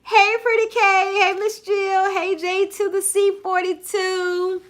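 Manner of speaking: high, loud, drawn-out hello; singsong greeting. Real, not acted. Live host greeting viewers by name, in high singsong. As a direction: call out brightly to arriving fans.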